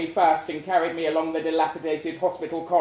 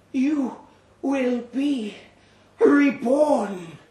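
higher, slow and honeyed, coaxing the patient; a gentle monster calming its prey; soothing creature voice; 12 semitones higher, 7 dB louder, slower than usual. Acted. Creature voiced over narration.

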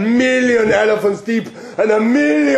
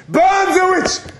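shout; 11 semitones higher, slower than usual. Acted. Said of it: "I will shout".

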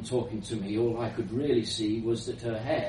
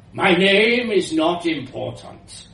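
put-on high, loud old woman with a foreign accent; elderly foreign woman; 5 semitones higher, 8 dB louder than usual. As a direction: brush the question off with accented authority. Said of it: "the voice continued".

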